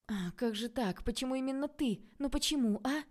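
high, wailing exasperation; exasperated lament. A narrator's reading. Tanya bemoans that it had to be him, high exasperated lament. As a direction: plead upward in mounting frustration.

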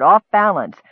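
usual speaking voice

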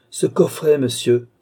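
high, loud, brief demand; curt. A narrator's reading. Countess ordering the magistrate to hand it over, curt. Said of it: "dit brièvement la comtesse".

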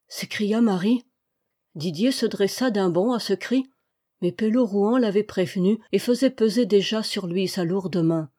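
ordinary delivery, nothing marked

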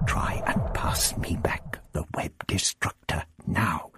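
low, slow, near-whispered mutter. A narrator's reading. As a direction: mutter it furtively under your breath. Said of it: "he muttered".

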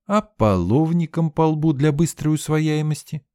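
put-on high bossy girl's voice; scolding girl. A narrator's reading. Sveta scolding the narrator about the mess. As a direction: boss him around, sharp and high.

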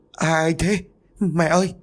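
high, panicked cry; terrified stammer. A narrator's reading. Son clutching a corpse-like body, crying out high in terror. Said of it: "Cậu liền ú ớ không thành câu".

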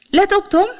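high, eager call to get attention; high-pitched heads-up call. A narrator's reading. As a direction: call out brightly to Tom, pitched well up. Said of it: "riep ze".

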